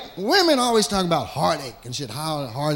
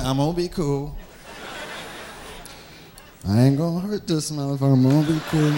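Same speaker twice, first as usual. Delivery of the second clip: low, tight trying-to-be-cool murmur; lower, quieter fake calm; forced calm self-talk; 6 semitones lower, 5 dB quieter, faster than usual. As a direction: talk yourself down, barely holding it. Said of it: "You go over and say".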